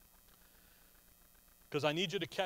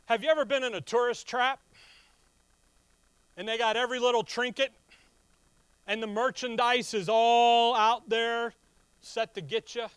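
excited; 4 semitones higher, 7 dB louder than usual. Real, not acted. Excited tourist trap aside.

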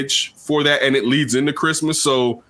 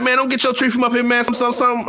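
higher, fast put-on voice for the worker's warning; imitating the worker; 10 semitones higher than usual. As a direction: rush the warning in a caricature voice. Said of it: "she's like".

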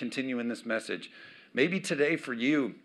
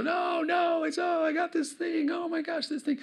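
put-on fussing child. Pastor acts out his kid refusing help, shrill high whine.

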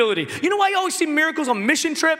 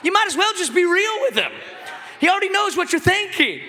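ordinary talk

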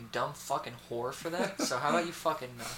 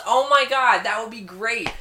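much louder, higher mock thrill; excited Chloe; 8 semitones higher, 12 dB louder than usual. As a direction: gush at the plan. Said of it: "Voices were spot on".

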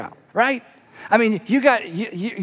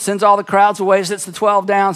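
usual speaking voice

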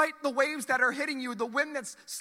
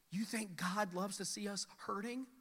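usual speaking voice

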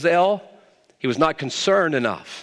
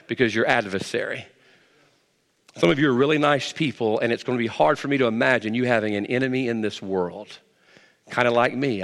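normal talking voice

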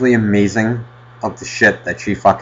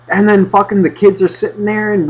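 ordinary talk, nothing marked

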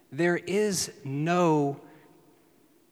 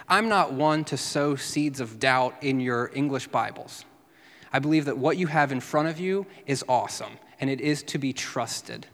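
ordinary delivery

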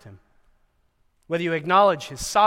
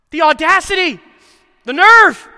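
high, loud hammed-up indignation; be scandalized, playfully over the top; mock outrage; 11 semitones higher, 12 dB louder than usual. Real, not acted. Preacher mocking the disciples' nerve, mock outrage.

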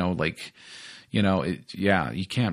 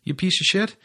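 higher, mocking rant at himself; mock self scolding; 7 semitones higher than usual. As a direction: sneer at your own snobbery. Scolding himself in his head.

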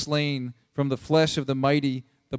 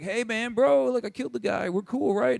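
high, chummy mock-casual voice; ingratiating bro; 8 semitones higher than usual. Two guys coming to David after killing, ingratiating bro. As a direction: play a killer angling for approval.

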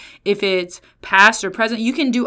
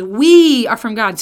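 "We" loud and drawn out; emphasis on We; 8 dB louder, slower than usual. Shown as a demonstration. Preacher returns to John's verse, stress on We. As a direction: lean long and hard on "We". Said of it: "the emphasis that I think".

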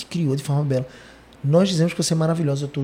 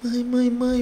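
higher, mock child calling for mom; 7 semitones higher, much slower than usual. Girl running to her mother's table, mock calling out. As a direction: lightly play a crying girl calling out. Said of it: "ela veio chorando pra nossa mesa".

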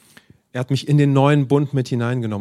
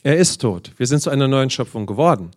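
normal talking voice

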